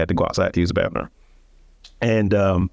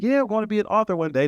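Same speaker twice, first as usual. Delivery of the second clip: much higher, fast, airy mock of a dreamer posting online; mocking wannabe; 12 semitones higher, faster than usual. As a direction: toss it off like a naive online post. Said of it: "I didn't post online saying".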